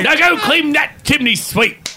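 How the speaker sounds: very high, slow mock British scolding; shrill hammed-up British voice; bad British accent. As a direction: squawk a drawn-out order in fake British. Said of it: "we did our shitty British accents".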